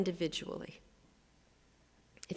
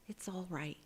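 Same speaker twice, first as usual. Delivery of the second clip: softer whispered it's all right; whispered self-comfort; soothing whisper; 9 dB quieter than usual. Shown as a demonstration. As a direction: hush yourself gently. Modeling self-soothing words.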